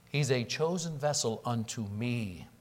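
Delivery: low, soft and slow; solemn divine voice. Acted. The Lord telling Ananias, slow, low and soft. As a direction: lay each word down gently and low. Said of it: "And then he says this".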